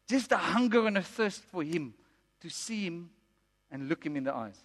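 soft, hushed longing; softly fading, reverent plea; tender appeal. Real, not acted. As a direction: let the voice sink to a gentle hush.

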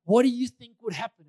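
imitating a preacher's insistent challenge; loud rhetorical challenge. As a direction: throw the question out to a crowd.

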